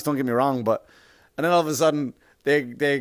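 normal talking voice

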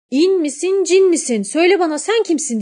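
high, loud call down the well. A narrator's reading. Girl calls into the well at the eyes, high loud call. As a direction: call out to whoever is below. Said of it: "diye seslenmiş aşağıya".

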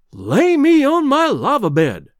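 high, loud, drawn-out cry of amazement; astonished exclamation. A narrator's reading. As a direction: draw it out high in goggle-eyed disbelief. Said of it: "said Greenbrier with bulging eyes".

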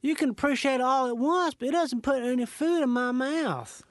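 a Southern passenger demanding his breakfast; gruff Southerner. Acted. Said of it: "like gruff Southerner".

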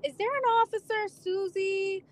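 high, puzzled radio dispatcher voice; a dispatcher asking, unsure, over the air; confused dispatcher. Retold or imitated. Dispatcher on the radio asking for Officer Susie, high and puzzled. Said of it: "on the air, she's like".

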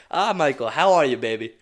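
mock greeting from far away; retelling the housekeeper's cheerful shout to him; imitating Evelyn calling.

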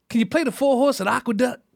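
put-on high, urgent old-grandma voice; imitated grandmother, high and insistent; dead-serious grandmother. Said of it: "And she was dead serious".

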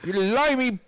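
high, mock British-accented jeer; mock British insult. Mocking a British listener with a high jeering British accent. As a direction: jeer like a comic Cockney. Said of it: "in a British accent".

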